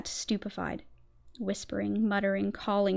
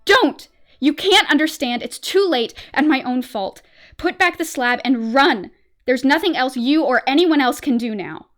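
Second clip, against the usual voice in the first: loud, high desperate scream; shrieking, hopeless plea; scream of despair; 4 semitones higher, 11 dB louder than usual. Acted. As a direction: beg him to go, in utter despair. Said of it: "changed to a scream of utter despair".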